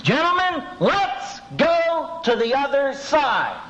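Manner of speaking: high, slow, calling out to his men; Jesus giving an order. Retold or imitated. Jesus tells the disciples to cross, high and slow. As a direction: Lord calmly announcing the crossing, high and drawn out.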